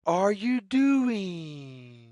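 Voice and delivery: ordinary delivery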